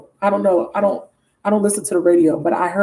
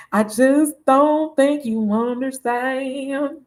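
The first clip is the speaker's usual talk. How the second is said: slow, higher mock-wounded lover; put-on heartbroken plea; hurt woman's voice; 4 semitones higher, much slower than usual. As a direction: drag it out, high and hammed-up hurt. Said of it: "That's what you saying".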